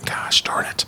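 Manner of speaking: hushed, frustrated whisper; whispered self-reproach. Real, not acted. Host curses himself for forgetting the name, whispering. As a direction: whisper it to yourself.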